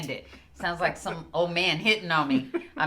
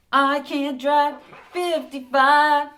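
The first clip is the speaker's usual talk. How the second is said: mock driver voice; 8 semitones higher, 7 dB louder, slower than usual. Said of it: "They're gonna say".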